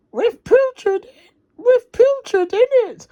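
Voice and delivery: very high, tearful voice; high worried Wendy; upset Wendy. Acted. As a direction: add it anxiously, near tears. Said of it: "Wendy added".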